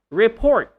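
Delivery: rePORT, loud high PORT; stress on second syllable. Shown as a demonstration. Teacher models the word, second-syllable stress. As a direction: Report with short re, punched PORT. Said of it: "So you don't say report".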